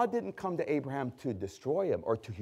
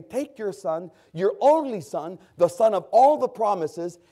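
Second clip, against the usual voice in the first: high, loud pulpit voice voicing God; preaching at full force; 5 semitones higher, 6 dB louder than usual. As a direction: thunder God's command from the pulpit.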